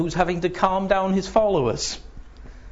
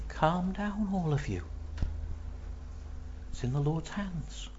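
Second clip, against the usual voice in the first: soft calming; 11 dB quieter than usual. Acted. David spreading calm.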